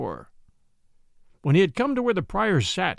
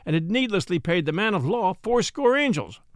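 ordinary delivery, nothing marked

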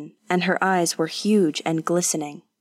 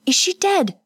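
put-on woman panicking over the fallen girl; rising terror; 11 semitones higher than usual. A narrator's reading. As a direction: voice climbing in terror on dead. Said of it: "Kazuko's voice rose with terror".

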